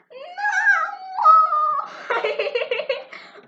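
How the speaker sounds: shrieking laugh that turns to crying; pained laughing shriek. Real, not acted. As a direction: howl with laughter at the burning pepper. Eats pepper blindfolded.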